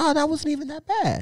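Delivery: very high-pitched fake nonchalance; mock bravado. Man shocked by the collar, mock bravado in a high voice. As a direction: squeak that it was nothing. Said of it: "I ain't no punk".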